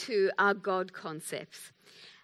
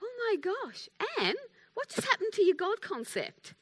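high, hushed self-reproach; shocked inner thought; 11 semitones higher than usual. Retold or imitated. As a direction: think it aloud in high, dismayed disbelief. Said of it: "I was in the freeze frame thinking".

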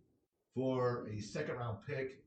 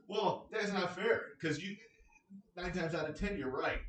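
imitating his friend; 6 semitones higher than usual. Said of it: "And he's like".

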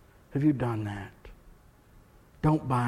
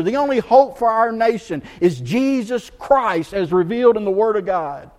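higher and louder, driving the warning home; full-force preaching; 9 semitones higher, 12 dB louder than usual. Acted. Pastor warning his church, preaching at full force. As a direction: preach it hard to the congregation.

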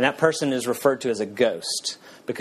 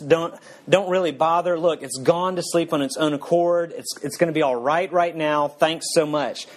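higher, nervous pleading ghost; 5 semitones higher than usual. Acted. Ghost begging the angel not to kill the lizard, higher and anxious. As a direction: talk him out of it, voice raised and anxious.

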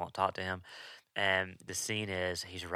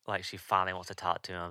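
ordinary talk, nothing marked

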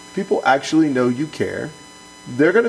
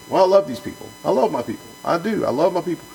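higher, gushing self-assurance; lightly mocked earnest protest; mock sentimental; 6 semitones higher, faster than usual. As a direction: play a pastor proud of a feeling. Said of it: "we just get stuck on a feeling".

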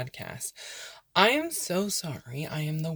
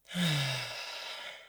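long, falling exhaled sigh; demonstrated sigh; 2 semitones lower, 6 dB quieter than usual. Shown as a demonstration. Meditation guide relaxing into the imagined place, long sigh. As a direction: release a slow audible breath. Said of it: "You can even add a sigh".

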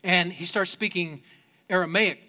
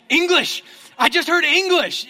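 high, loud burst of delight; excited exclamation; 7 semitones higher, 6 dB louder than usual. Retold or imitated. Traveller in China suddenly hears English, excited.

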